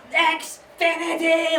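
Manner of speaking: high, drawn-out scream; exasperated scream. Retold or imitated. Aunt correcting her hard-of-hearing mother, screamed. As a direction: scream it, stretching out the word. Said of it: "Like, just screams".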